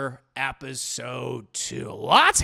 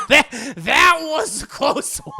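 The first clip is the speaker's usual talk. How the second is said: slow, high repeat breaking into laughter; laughing relief; 9 semitones higher, 9 dB louder, slower than usual. Retold or imitated. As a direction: repeat it, cracking up. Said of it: "And Dio's like".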